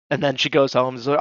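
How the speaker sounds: normal talking voice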